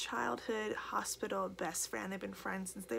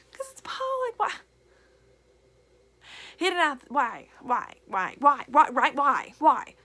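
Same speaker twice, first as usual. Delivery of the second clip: louder, anguished repeated whys; real frustration, louder cries of why; frustrated why; 5 semitones higher, 11 dB louder than usual. Real, not acted. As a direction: hammer each why in frustration.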